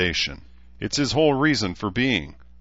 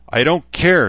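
louder, higher yell of I don't care; 9 semitones higher, 9 dB louder than usual. A narrator's reading. Shida yells at her busy sister, impatient yell. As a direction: shout it over her. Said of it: "Shida yelled".

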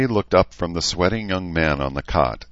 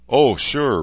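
higher, louder acid retort; acid sarcasm; 9 semitones higher, 6 dB louder than usual. A narrator's reading. Rachel scoffing at the pig question, acidly sarcastic. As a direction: say it acidly, pitched up. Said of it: "Rachel said acidly".

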